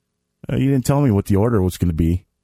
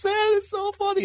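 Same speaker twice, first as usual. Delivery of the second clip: very high imitation of a young son, a bit softer; child voice; 5 dB quieter than usual. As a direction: squeak it out like a delighted eight-year-old. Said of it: "And my son goes".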